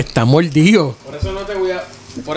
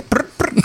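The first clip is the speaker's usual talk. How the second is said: voiceless lip trill, "prr prr"; mock noise; 6 dB quieter than usual. Telling how he ran off, "prr prr". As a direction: trill the lips like a quick getaway. Imitating a sound in a story.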